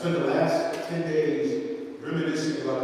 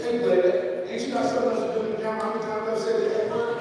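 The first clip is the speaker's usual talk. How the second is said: higher, fast sassy comeback; mock indignant Fred; 8 semitones higher, faster than usual. Fred teasing the maitre d' at the steakhouse, sassy and high. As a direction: toss it back at the waiter, cheeky.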